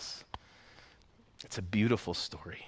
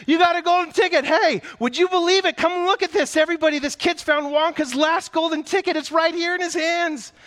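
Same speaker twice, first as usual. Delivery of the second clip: very high, loud, fast shrieking; excited shouting; 11 dB louder than usual. Acted. Shopkeeper calls everybody to see the ticket, shrieking. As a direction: yell it to the whole shop. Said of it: "screamed the shopkeeper".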